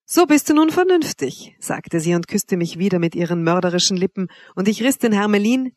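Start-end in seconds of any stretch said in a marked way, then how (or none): 0.1-1.6 high, loud, playful exclamation; delighted approval. A narrator's reading. Wanda approving Severin before kissing him, delighted. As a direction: praise teasingly in a raised voice.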